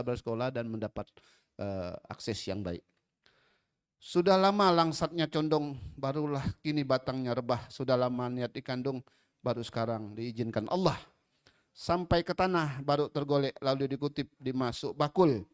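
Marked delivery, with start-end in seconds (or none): 4.0-15.5 poem recital. Acted. Recites a pantun verse.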